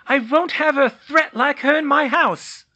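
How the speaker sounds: high, very loud aged snarl; aunt yelling her refusal; furious old aunt. Acted. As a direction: hurl it back in contempt. Muriel yelling back.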